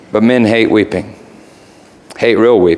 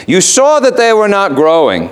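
the raven; 10 semitones higher than usual. Acted. Raven voiced in retold story.

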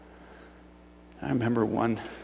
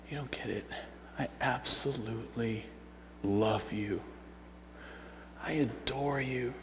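tender devotion; 12 dB quieter than usual. Acted. Jesus looking at the woman at the well, tenderly hushed.

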